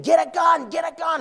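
high-pitched mock scream; imitated screaming. Onlookers screaming for a gun during a lion attack, imitated screaming. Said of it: "They were screaming".

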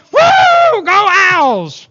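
high, loud whoop into a chant; full-voiced fan yell; sports fan cheer. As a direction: cheer the team on from the bleachers. Acts out fan at ball game.

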